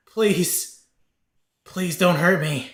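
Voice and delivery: high, loud, terrified begging; frightened pleading. Acted. Cornered dwarf begs his attackers for mercy, frightened pleading.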